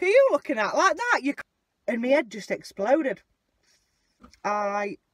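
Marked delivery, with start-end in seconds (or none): none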